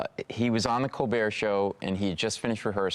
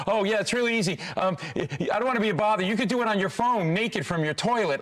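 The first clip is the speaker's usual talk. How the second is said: flustered babbling; 9 semitones higher than usual. Retold or imitated. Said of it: "I go".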